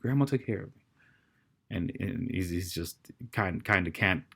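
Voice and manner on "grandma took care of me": put-on young son consoling. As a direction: gently console a weeping father. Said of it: "the son's trying to console him".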